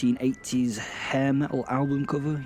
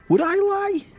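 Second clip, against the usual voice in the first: much higher, louder squeal of a question; mock indignant; 6 dB louder than usual. Narrator swearing the Satan tale is true, mock indignant. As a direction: protest it in a high-pitched voice.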